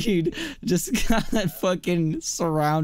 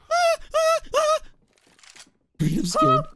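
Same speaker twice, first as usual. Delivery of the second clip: high squealing mock fear; mock panic. Gamer squealing to teammate Reed, hammed-up fear. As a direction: squeal for help like a scared kid.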